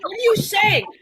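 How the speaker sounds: lightly hammed-up frustration; mock exasperated yell. Girlfriend baffled by Scottish boyfriend, mock exasperated yell. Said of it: "you're yelling at me".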